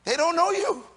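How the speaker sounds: loud, ringing preacher's build; full-voiced sermon climax; preaching at full force. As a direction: drive it home to the congregation.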